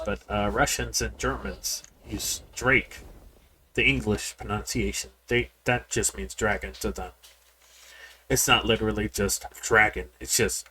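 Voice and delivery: ordinary talk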